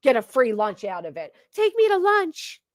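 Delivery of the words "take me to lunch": higher, slow whiny mimic; mocking whiny voice. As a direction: whine it like a spoiled child. Mocking quote of the woman.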